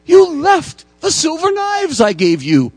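high, loud and slow kindly priest; high slow priestly delight; gushing priest. Retold or imitated. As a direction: play the delighted priest, drawn out. Priest greets returned thief.

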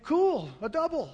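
high, loud, thrilled exclamation; excited boy's thought. Retold or imitated. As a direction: exclaim like a delighted kid. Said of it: "I think".

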